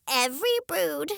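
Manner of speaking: high, prim hen voice; proud high-voiced hen declares; hen declaring. A narrator's reading. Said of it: "she declared".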